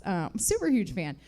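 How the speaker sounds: ordinary delivery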